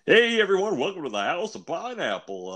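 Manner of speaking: high show-host greeting; announcer voice. Acted. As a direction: open the show with energy. Third take of intro.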